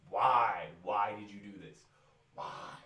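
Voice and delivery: loud, exasperated outburst at the booking; exasperated why. Real, not acted. Wrestling fan vlogger to WWE, a loud exasperated why. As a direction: demand an answer, fed up.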